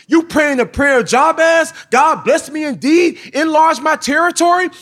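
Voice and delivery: God listing blessings. Retold or imitated. God reminding the husband of his blessings, high preaching voice. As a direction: count his blessings back at him. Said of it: "He said".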